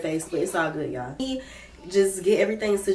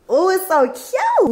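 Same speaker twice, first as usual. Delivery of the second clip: gushing; 11 semitones higher, 3 dB louder, slower than usual. Real, not acted. Gushing over brown jacket.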